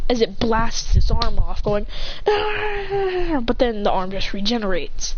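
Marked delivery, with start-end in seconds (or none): 2.3-3.4 long high wail; drawn-out imitated cry of pain; monster's cry. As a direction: wail as if just wounded. Monster cries as arm blasts off.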